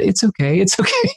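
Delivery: put-on high, sing-song little-kid reassurance; imitating child soothing. Father imitates his son soothing a fallen kid, very high. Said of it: "put his arm around him and said".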